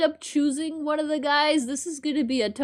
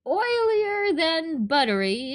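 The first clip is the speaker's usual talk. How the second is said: ordinary delivery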